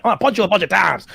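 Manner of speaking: put-on voice of a wild brawler; mock brawler voice. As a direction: play a flailing fighter.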